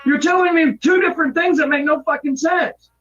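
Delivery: angry shouting. Real, not acted. Frustrated worker yells at coworker.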